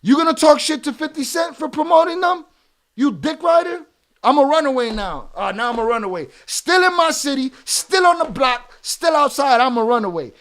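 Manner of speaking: loud, heated shouting; furious rant. Real, not acted. Streamer yells at chatter calling him a hoe, furious. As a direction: yell him down. Streamer berating chat viewer.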